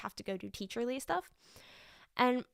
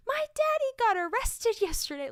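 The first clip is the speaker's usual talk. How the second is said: put-on higher and louder, a proud little boy announcing; proud kid; 12 semitones higher, 6 dB louder than usual. Second grader proudly telling classmates his news. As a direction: boast it like a seven-year-old. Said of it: "very proudly said to his classmates".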